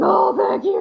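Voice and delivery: high, gushing thanks; retold relief. Sleepless woman when Jesus shows up, acted relief.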